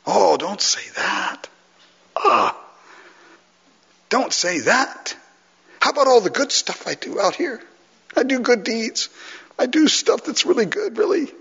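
high-pitched mock whining; whiny protest. Objecting that good deeds should count, whiny protest. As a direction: plead in a put-on, hurt little voice. Acted protest at the hard truth.

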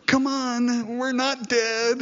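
high, strained show of happiness; forced cheer. Retold or imitated. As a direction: fake a bright smile in your voice. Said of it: "there's this real strain".

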